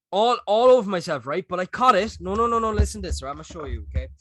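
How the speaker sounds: normal talking voice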